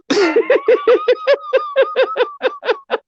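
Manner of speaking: high shrieking laugh; helpless high-pitched cackle; howling laugh. Real, not acted. Friend howling with laughter at the roast, shrieking cackle.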